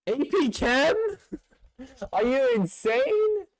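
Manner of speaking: shocked that anyone would pick that; incredulous. Real, not acted. Incredulous at suggestion.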